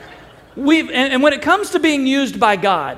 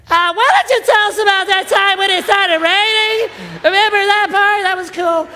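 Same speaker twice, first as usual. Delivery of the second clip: much higher and louder squeaky self-mockery; 11 semitones higher, 4 dB louder than usual. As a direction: pipe up in a tiny, high, nervous voice. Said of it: "this is how it sounded".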